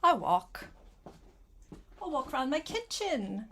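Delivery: usual speaking voice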